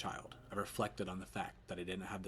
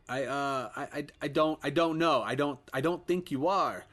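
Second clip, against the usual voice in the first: stammering fear; 6 semitones higher, 8 dB louder than usual. Acted. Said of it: "Ed never asked me this before".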